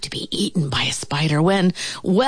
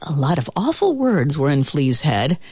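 ordinary delivery

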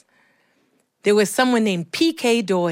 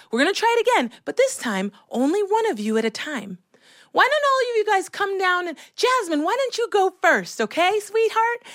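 high-pitched, coaxing drama teacher; gently nominate a child to go first; lilting teacher voice for small children; sweet teacher; 10 semitones higher than usual. Retold or imitated. Teacher sweetly directs kids.

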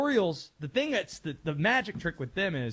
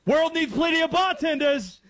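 loud, high-pitched movie-boss shout; Alec Baldwin's Departed character; 11 semitones higher, 7 dB louder than usual. Acted. Quoting The Departed to the radio audience, loud character impression. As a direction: holler the line as a film tough guy. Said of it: "sounding like Alec Baldwin's character".

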